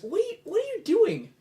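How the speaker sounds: high startled NPC voice. Acted.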